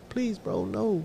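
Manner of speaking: soft, whimpering plea imitating a beaten boy; mock crying plea. Retelling the crying opponent, soft whimpered plea. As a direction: beg weakly like the crying kid. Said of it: "the little boy is crying".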